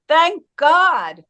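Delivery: mild mock cry of relief; retold scream. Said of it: "he screamed".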